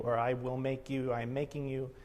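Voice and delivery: ordinary talk, nothing marked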